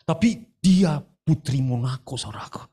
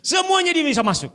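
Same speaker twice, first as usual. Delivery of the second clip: much higher, ringing declaration; high emphatic proclamation. Real, not acted. Preacher's high emphatic climax. (seruan tinggi penuh semangat)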